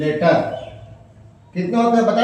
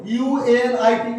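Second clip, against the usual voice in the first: slow letter-by-letter spelling; spelled out letter by letter; much slower than usual. Shown as a demonstration. Teacher slowly spelling unit for the class. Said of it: "देखो".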